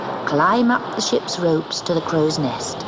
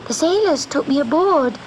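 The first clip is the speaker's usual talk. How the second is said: much higher, small kitten voice; pleading kitten; 11 semitones higher than usual. A narrator's reading. Kitten explaining to the Sea Witch, high and helpless.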